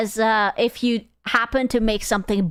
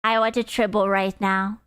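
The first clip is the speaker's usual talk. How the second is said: ordinary talk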